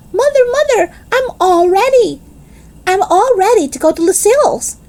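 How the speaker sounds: put-on high, eager child calling out; bursting to go, calling for Mother; excited calling. Girl calls her mother, eager to leave for Lucille's, high and excited.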